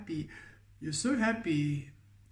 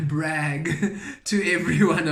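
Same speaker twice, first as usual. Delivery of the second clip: louder, words bubbling through laughter; laughing; 8 dB louder than usual. Real, not acted. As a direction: break into a laugh around the word. Amused by her own word choice.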